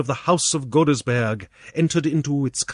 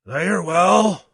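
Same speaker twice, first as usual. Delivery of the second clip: slow, slightly higher sighing reply; 4 semitones higher than usual. A narrator's reading. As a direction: answer slowly, as if with a sigh. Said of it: "with a sigh".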